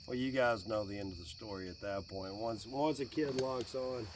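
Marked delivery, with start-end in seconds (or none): none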